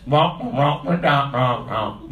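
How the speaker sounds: wordless wah-wah droning; Peanuts teacher. Acted. What kids hear the teacher say, cartoon wah-wah. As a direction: imitate muffled, meaningless grown-up speech. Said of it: "That's what they hear the teacher say".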